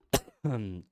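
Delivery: harsh real cough; coughing fit. Real, not acted. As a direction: cough out loud. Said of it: "Désolé si je, si je tousse un peu là".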